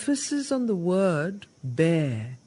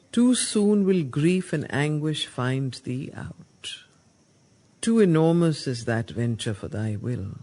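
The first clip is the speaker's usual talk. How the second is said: solemn recitation; 4 semitones lower, 4 dB louder than usual. Acted. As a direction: intone it like a warning from scripture. Reading Savitri verse.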